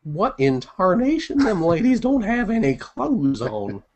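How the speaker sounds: put-on high, slow old-timer prospector drawl; grizzled old prospector. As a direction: play a crusty gold-rush prospector. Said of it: "my grizzled old prospector discovering the internet".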